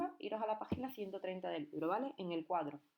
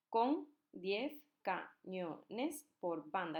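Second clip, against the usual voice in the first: very slow, each syllable spaced apart; slow syllable reading; much slower than usual. Shown as a demonstration. Teacher reading a verse to students, counting syllables slowly. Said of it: "vamos a contar las sílabas".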